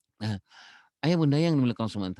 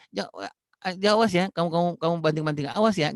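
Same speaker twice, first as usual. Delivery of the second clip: put-on higher, louder warning snapped at a child; scolding parent; 7 semitones higher, 4 dB louder than usual. Parent warning a child who throws things, angry and loud. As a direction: threaten the kid who keeps throwing things.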